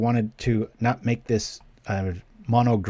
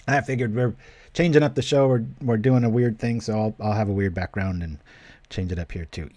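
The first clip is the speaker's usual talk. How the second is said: normal talking voice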